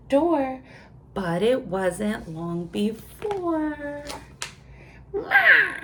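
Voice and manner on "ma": high bleating "Ma"; goat bleat. A narrator's reading. Parent reading to a child, bleating like the goat. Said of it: "said the goat".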